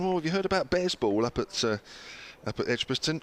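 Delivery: put-on high, lilting Kiwi accent; New Zealand accent. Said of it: "is that an attempted New Zealand accent?".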